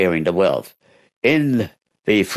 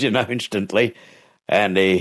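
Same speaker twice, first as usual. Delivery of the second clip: usual speaking voice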